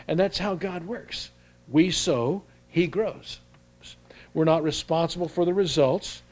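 rising preacher's cry, pitch lifted; fervent sermon build; preaching. Acted. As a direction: preach it out to the congregation. Preacher builds to full force.

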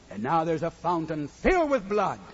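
ordinary talk

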